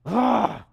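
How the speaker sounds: heavy groan of anguish; emotional groan. Shown as a demonstration. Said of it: "almost like a groaning with great emotion".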